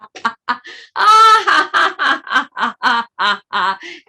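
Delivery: loud rolling laugh; long forgetful laugh. Shown as a demonstration. Teacher leading another round of laughter, rolling laugh. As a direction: keep the laugh going. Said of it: "And then".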